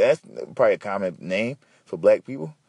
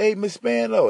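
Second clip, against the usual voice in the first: called out greeting; 10 semitones higher than usual. Retold or imitated. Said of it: "And I yelled at her".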